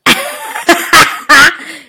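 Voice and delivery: high, loud laughing repeat; mock the job title through laughs; slow, laughing sandwich artist; laughing mockery. Real, not acted.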